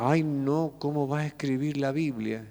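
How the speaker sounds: imitating a prudish believer, hushed; mock scandalized churchgoer. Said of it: "Algunos dicen".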